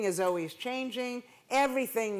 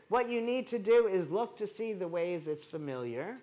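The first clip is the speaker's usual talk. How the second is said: usual speaking voice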